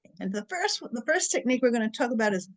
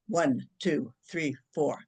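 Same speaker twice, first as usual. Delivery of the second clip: low, even count with spaces between numbers; measured counting; 7 semitones lower, 4 dB quieter, slower than usual. Shown as a demonstration. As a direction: count them calmly through the breath. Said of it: "and I'll go".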